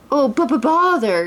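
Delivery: Pooh bouncing below; exclaiming. A narrator's reading. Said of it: "exclaimed Pooh".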